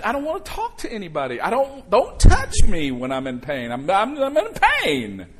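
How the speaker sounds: hammed-up cranky patient pushing people away; grouchy lash-out. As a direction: snap like someone hurting and sick of it. Grouchy person in pain lashing out.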